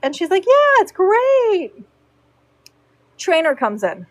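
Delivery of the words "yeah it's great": high delighted yeah. Retold or imitated. Client answering groom, high and slow with delight.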